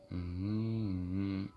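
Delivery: soft, long hum; drawn-out hum. Real, not acted. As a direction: hum quietly while you wait. Hums while waiting during the test.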